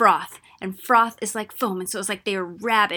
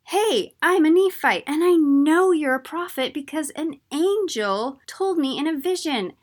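much higher and louder, bursting with eager news; excited; 7 semitones higher, 5 dB louder than usual. Acted. Amulek thrilled to meet Alma, high excited outburst. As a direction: blurt it out, thrilled to meet a prophet.

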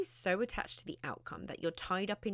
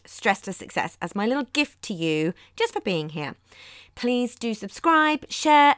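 podcast intro; 11 dB louder than usual. Acted. Loud podcast host intro read.

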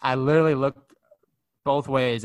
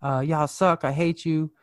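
raised-pitch retold rant; angry cursing; 6 semitones higher, slower than usual. Upset lady cursing at dispensary staff, mock angry. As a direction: imitate an upset customer cursing. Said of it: "really upset and starts getting really mad".

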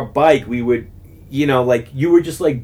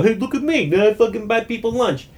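much higher, showy self-praise; mock bragging; 10 semitones higher than usual. Mocking a show-off who buys lunch, high smug bragging. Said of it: "that's not like a".

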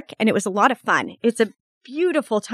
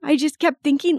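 higher, tearful laugh on the words; real emotion breaking through, half laughing; choked up, laughing through tears; 5 semitones higher than usual. Real, not acted. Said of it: "This is making me emotional".

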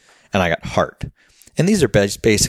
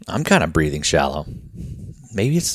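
ordinary delivery

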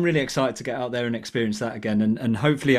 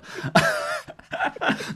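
a sudden breathy laugh that breaks into his sentence; burst of laughter; 3 dB quieter than usual. Real, not acted. Co-host laughing as the podcast runs out of time, breathy burst of laughter.

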